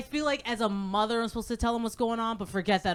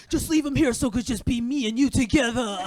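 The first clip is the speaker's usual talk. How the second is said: a hint of a sinister child, louder toward the mother; lightly voiced creepy daughter; 5 dB louder than usual. Daughter asking mother to leave baby, lightly creepy. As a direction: voice a jealous child's eerie plea, lightly. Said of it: "looks at me, not even blinking".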